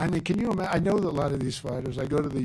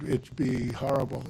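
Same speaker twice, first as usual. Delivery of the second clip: usual speaking voice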